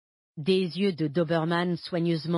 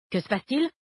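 puzzled question, pitched up and rising at the end; taken aback, higher-pitched query; astonished; 6 semitones higher, faster than usual. A narrator's reading. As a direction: ask it in surprise, voice lifting. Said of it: "s'étonna Ramos".

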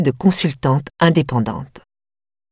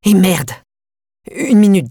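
angry outburst; 4 semitones higher, 4 dB louder than usual. Acted. Grieving son's outburst.

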